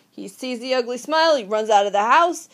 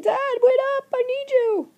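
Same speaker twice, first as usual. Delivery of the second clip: put-on high childlike creature voice; pleading creature; 13 semitones higher than usual. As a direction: call after dad like a lost little kid. Creature voiced calling father.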